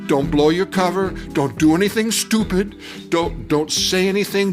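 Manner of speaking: ordinary delivery, nothing marked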